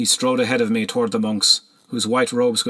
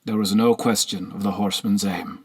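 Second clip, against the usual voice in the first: ordinary delivery, nothing marked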